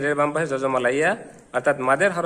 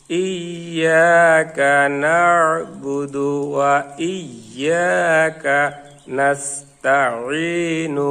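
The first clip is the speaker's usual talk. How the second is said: very slow chanted verse, final syllable left open; slow joined recitation; 3 dB louder, much slower than usual. Shown as a demonstration. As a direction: recite it joined up, without the closing stop. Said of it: "আস্তে আস্তে করে". (আস্তে আস্তে মিলিয়ে তেলাওয়াত)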